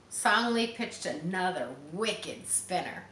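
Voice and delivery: ordinary delivery, nothing marked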